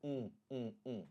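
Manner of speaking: soft isolated nasal 'mm', really an open 'oo'; short, soft nasal vowels for 'mm'; nasal U, lips open. Shown as a demonstration. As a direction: repeat 'mm' through the nose, lips never closing. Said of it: "not pressing my lips".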